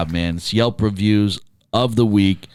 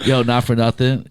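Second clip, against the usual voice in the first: usual speaking voice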